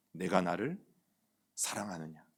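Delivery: soft, low and hushed, asking tenderly; gentle question of the Lord. Acted. The Lord quietly asks the disciple if he loves him, tender hush. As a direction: ask it quietly like a loving master. The Lord's single question voiced.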